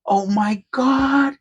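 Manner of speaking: high and slow, drawn out in awe; stunned disbelief. Real, not acted. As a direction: let the shock stretch it out. Finds Indian head penny.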